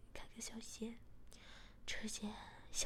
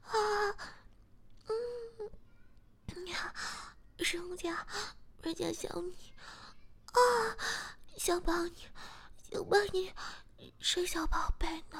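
breathy pleading; 7 semitones higher, 7 dB louder than usual. Acted. Heroine pleading in love scene.

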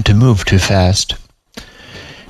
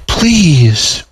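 high, drawn-out plea; pleading; 7 semitones higher than usual. A narrator's reading. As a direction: beg with one long, rising-then-falling word. Client begs her to do it.

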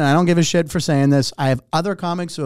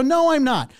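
retold defiant refusal; 8 semitones higher, slower than usual.

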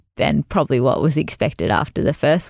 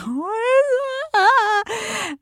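a much higher, very slow, stretched wail; shocked cry; much slower than usual. Retold or imitated. Reacting to the pregnant model falling down, stretched shocked cry. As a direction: cry it out in disbelief as she falls. Friend suddenly collapses at rehearsal.

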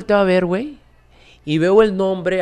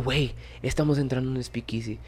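excited whisper; 10 dB quieter than usual. Retold or imitated. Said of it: "bien emocionado".

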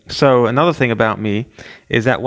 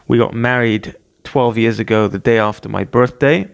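ordinary talk, nothing marked

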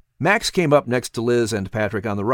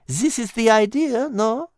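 put-on high, lilting French-accented cat voice; a wise cat coaxing gently; cat Liz; 10 semitones higher than usual. Cat Liz coaxing Patrick in a high French-tinged voice.

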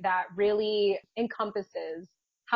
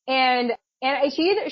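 normal talking voice

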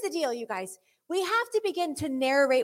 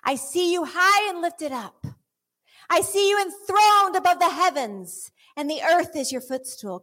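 modelled praise; 6 semitones higher, 4 dB louder than usual. Shown as a demonstration. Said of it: "begin to narrate what it is that you see".

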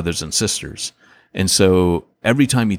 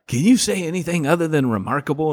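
higher-pitched mock complaint; lightly acted fed-up little babysitter; pleading mock exasperation, pitched up; imitating exasperated child; 7 semitones higher, faster than usual. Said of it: "he goes like this".

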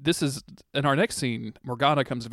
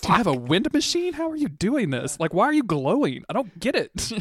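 higher, bewildered mock voice; mock baffled Arthur; 7 semitones higher than usual. Cohost voicing Arthur asking Morgana about her glow, high and baffled. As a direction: ask it like a dazzled prince. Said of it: "Arthur is like".

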